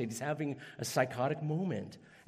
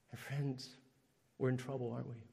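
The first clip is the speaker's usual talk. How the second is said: much softer, confiding to the listeners; quiet aside; 3 semitones lower, 7 dB quieter than usual. Acted. Preacher confiding to friends in the pews, soft. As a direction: lean in and say it gently. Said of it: "intimate aside to congregation".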